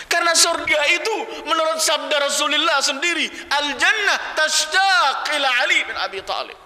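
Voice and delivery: high, impassioned sermon cry; full-force preaching. Acted. (khotbah lantang berapi-api)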